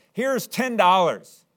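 high, loud and slow, proud; proud boy. Acted. Boy paying the shopkeeper for his boat, high proud announcement. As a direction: slap the money down proudly. Boy pays at pawn shop.

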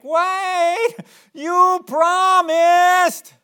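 hammed-up, very high drawn-out whine; exaggerated mock-child wail; whining. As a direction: whine it loudly like a pleading kid. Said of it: "They usually say it kind of whining like".

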